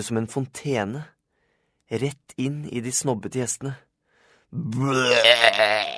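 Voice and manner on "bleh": loud, drawn-out retch; acted gushing puke sound; vomiting noise. A narrator's reading. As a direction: throw up noisily in one long burst. Narrator imitates the vomiting.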